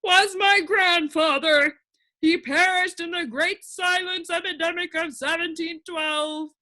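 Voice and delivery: high, lament for his grandfather; high creature voice, sorrowful; the din, grieving. A narrator's reading. Din's voice.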